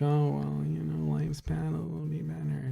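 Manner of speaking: soft, lightly mocking resigned voice; a hint of mock sad-sack reassurance; resigned comedian impression. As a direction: imitate someone weakly consoling, quietly. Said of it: "His whole thing is like".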